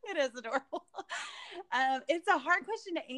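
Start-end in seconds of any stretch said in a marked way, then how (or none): 0.0-0.8 아주 높게 터지는 웃음 뒤 웃으며 말함; 웃음을 터뜨리며 말하기; high giggle. Real, not acted. Laughing at friend's remark.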